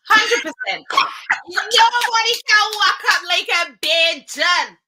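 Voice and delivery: emphatic and high, with full certainty; confident. Real, not acted. Answering the host on who has the best waistline, confident. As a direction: declare it proudly, punching the phrases out high. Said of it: "that was so confident".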